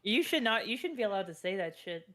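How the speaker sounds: ordinary talk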